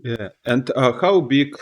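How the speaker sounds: normal talking voice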